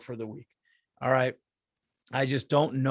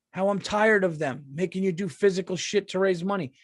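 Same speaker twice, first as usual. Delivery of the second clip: usual speaking voice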